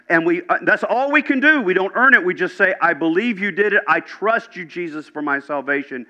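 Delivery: high, forceful gospel proclamation; impassioned preaching. Real, not acted. Preacher proclaiming God loves sinners, impassioned and high. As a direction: preach it with rising urgency, pitched high.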